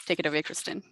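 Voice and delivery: usual speaking voice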